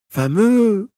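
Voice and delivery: high, loud cry of surprise; patient amazed the pain is gone; delighted exclamation. A narrator's reading. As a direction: burst out in amazed joy. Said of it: "s'écria-t-il".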